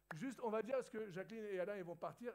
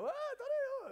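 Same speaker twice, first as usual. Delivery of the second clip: calling out; 6 dB louder, much faster than usual. Real, not acted. Calling to church members leaving.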